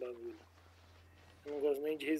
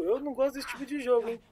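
much higher, louder joking voice; high put-on voice; 9 semitones higher, 8 dB louder than usual. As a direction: squeal it like a scared kid. Intro line redone in put-on voice. (voz aguda e exagerada)

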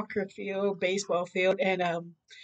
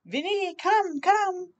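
Mama calling; 8 semitones higher, much slower than usual. Acted. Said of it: "there's the mummy shouting".

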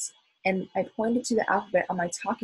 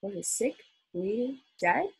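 usual speaking voice

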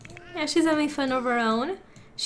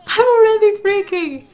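louder, higher panicked yelp; freaked-out outburst; 7 semitones higher, 10 dB louder than usual. Real, not acted. Gamer panicking as the kids wake up, loud freaked-out yelp. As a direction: let the panic burst out. Stressed over Sims game.

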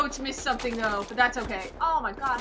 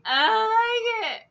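high, slow, loud whine of dread; frightened wail; 9 semitones higher, 6 dB louder, slower than usual. Real, not acted. Player telling Sally of the dread, frightened wail. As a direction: drag it out in fear.

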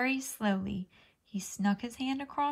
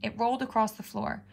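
plain reading voice

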